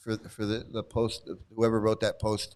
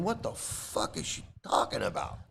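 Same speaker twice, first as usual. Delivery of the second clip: put-on baffled boyfriend; 6 dB quieter than usual. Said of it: "He's going".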